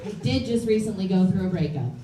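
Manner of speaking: ordinary delivery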